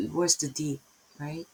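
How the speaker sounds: usual speaking voice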